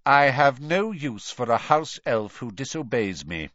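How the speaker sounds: icy, deliberate dismissal; cold. A narrator's reading. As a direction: dismiss the servant with restrained contempt. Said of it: "he said coldly".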